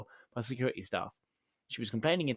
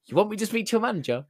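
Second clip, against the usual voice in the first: fast, higher, louder mock outrage; indignant disbelief; 9 semitones higher, 6 dB louder, faster than usual. Customer threatening to fetch the manager, high indignant outburst. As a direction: fire it back, scandalised.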